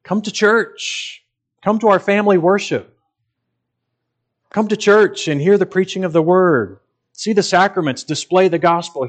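eager invitation. Pretend. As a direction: invite them in with a lifted, keen voice. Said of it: "but you can just say".

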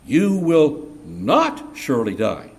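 "not" slowed and pressed hard in "You will not surely die"; stress on not. Shown as a demonstration. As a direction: land firmly on "not", slow and deliberate. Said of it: "he adds one word".

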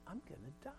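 very soft, retold moment of dread; quiet inner thought; hushed realization. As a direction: let the thought land almost under your breath. Sudden realization of death, retold.

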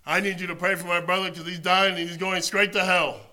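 loud, pressing prayer request; loud urgent plea. Retold or imitated. Friend phoning the preacher, urgent loud plea. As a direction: demand prayer at full volume. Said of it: "and he'd be like".

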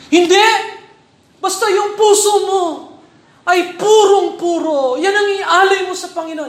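loud, high mock protest; hammed-up indignant voice; mocking opponent. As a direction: parody the sloppy believer's excuse. Mocking other church's view.

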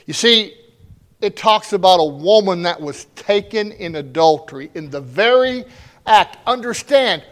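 loud, high, fervent sermon delivery; preaching at full force. Acted. Pastor driving home the point to his church, full-force preaching. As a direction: preach it out to the congregation.

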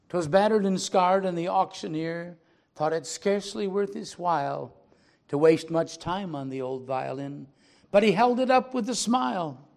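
dramatic poem reading, building in force; poem recitation. A narrator's reading. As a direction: read the poem like a storyteller before the church. Pastor recites poem aloud.

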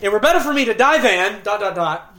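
an oath cut off mid-breath; sentence left hanging. Shown as a demonstration. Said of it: "And then he pauses".

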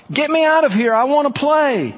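put-on high, loud childlike plea; crying out. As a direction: call out like a kid demanding release. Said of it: "He hears my cry".

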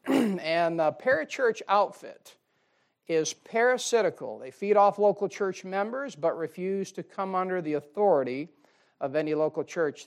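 normal talking voice

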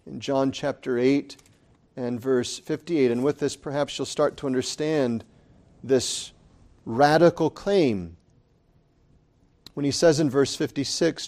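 ordinary talk, nothing marked

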